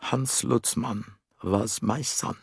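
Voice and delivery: quiet, dry character voice with measured pauses; dry middle-aged man. A narrator's reading. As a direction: say it drily, softly, as an older man. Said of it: "The dry, middle-aged voice said".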